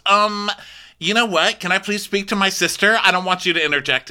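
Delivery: a high, prim brush-off; mocking Kyle. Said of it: "And she goes".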